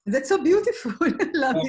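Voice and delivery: high, loud, laughing appreciation; real delight. Real, not acted. Host loves what the participant shared, real delight.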